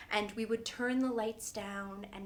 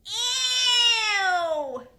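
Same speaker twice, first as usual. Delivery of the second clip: high, loud drawn-out ew; disgusted shriek; 11 semitones higher, 12 dB louder than usual. Acted. Boy standing up on his nap mat, shrieking in disgust. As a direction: shriek it like a grossed-out little boy.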